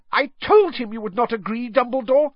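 high, loud angry outburst; storming. Acted. McGonagall storming at Fudge over the Dementor. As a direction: storm at him in fury. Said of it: "stormed Professor McGonagall".

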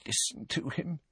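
high, very soft fond aside; motherly healer. A narrator's reading. Healer speaking fondly of her patient, soft motherly coo. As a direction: coo it indulgently.

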